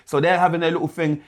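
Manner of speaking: usual speaking voice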